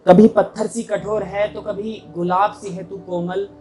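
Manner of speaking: ringing openings, falling line ends; mushaira-style verse delivery; poetry recitation. Acted. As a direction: recite to an audience, measured and emphatic. Recites own poem to audience.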